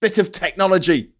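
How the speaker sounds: high, loud mock indignation; hammed-up offended fan; imitating an outraged Apple fan; mock outrage. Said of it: "They're like".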